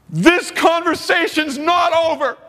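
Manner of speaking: high, drawn-out shout; mock yell. Man chasing him out of the building, mock shouting. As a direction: holler it after someone who is leaving.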